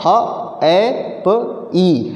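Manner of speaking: ह, ए, प, ई split apart with short gaps; each of ह, ए, प, ई as its own sound; four separate sounds. Shown as a demonstration. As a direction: count out the phonemes ह, ए, प, ई singly. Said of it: "इसमें चार sound निकल रहे हैं".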